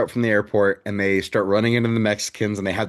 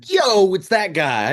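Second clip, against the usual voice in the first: drawn-out, higher-pitched excited shout-out; excited recognition; 8 semitones higher, slower than usual. Retold or imitated. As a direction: act out freaking out at spotting a familiar actor. Retelling own excited freak-out.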